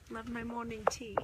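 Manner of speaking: playful imitation of a British accent; attempted British accent. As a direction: have a go at a British accent, missing it. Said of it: "that was not the right accent".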